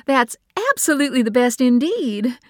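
laughing. Acted.

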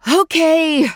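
loud high giving in. Acted. Mother gives in to her daughter, loud and urgent. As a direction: give up the fight, urgently.

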